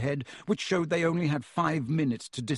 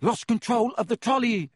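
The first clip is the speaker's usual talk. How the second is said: higher-pitched, gasped explanation; gasped reply; 8 semitones higher, 4 dB louder than usual. A narrator's reading. Boy clutching his ribs answers the guard, gasping. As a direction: blurt it out as if winded. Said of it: "Harry gasped".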